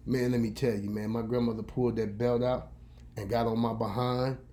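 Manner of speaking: ordinary delivery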